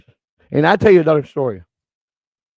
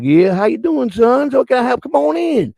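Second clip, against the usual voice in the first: put-on high, lilting Southern old-lady voice; 9 semitones higher, slower than usual. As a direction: play a welcoming old Southern lady, pitched up. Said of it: "answered the door with a southern accent".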